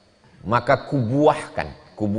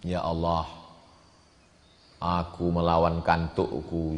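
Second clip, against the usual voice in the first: humble prayer; 5 semitones lower, 4 dB quieter than usual. Acted. Imagined fourth man's prayer.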